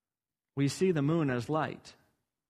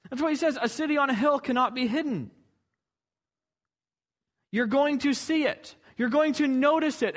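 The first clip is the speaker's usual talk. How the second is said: fervent preaching; 7 semitones higher, 4 dB louder than usual. Acted. Preaching rises in force.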